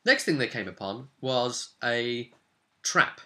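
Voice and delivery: ordinary talk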